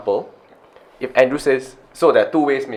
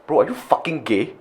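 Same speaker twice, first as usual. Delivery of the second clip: rude jab; 4 semitones higher, 6 dB quieter than usual. Shown as a demonstration. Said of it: "That is different intent".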